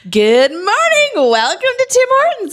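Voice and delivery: sing-song drive-through greeting; perky greeting. Retold or imitated. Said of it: "I'd be like".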